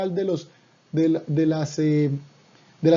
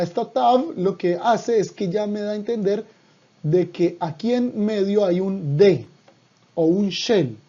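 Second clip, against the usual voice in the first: ordinary delivery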